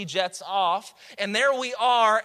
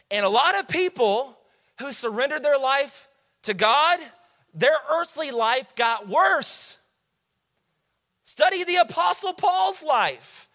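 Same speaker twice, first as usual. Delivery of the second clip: full-force preaching; 6 semitones higher, 4 dB louder than usual. Acted. Pastor exhorting the parents, loud and high. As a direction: drive it home to the congregation.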